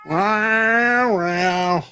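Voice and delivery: cat meows. Pretend. Meowing at the cat.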